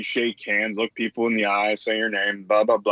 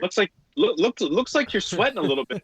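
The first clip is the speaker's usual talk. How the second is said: higher, teasing mock voice; 6 semitones higher than usual. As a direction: needle her in a put-on voice. Mocking voice at sweating grandma.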